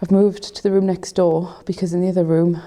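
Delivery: normal talking voice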